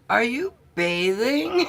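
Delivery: a lady shocked to find someone by the river; startled question. Retold or imitated. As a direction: ask it like you can't believe it. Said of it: "she goes".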